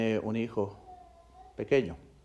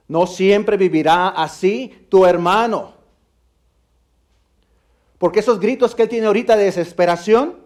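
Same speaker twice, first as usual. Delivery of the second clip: triumphant proclamation; 8 semitones higher, 12 dB louder than usual. Acted. The pastor declaring hope to his daughter, loud and triumphant.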